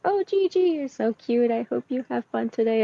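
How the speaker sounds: high, sweet coo, easing down; cooing to a pet. Acted. Twyla greets her sugar glider by the fireplace, cooing. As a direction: coo fondly over a cute little animal.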